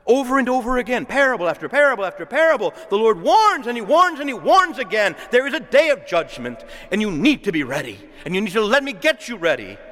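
fervent pulpit crescendo; preaching at full force. Acted. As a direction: thunder the warning from the pulpit.

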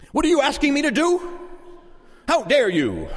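high, affronted question dropping to a curt rebuke; indignant. Acted. Person pressed into carrying a load, acted indignant outrage. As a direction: act offended at the very request.

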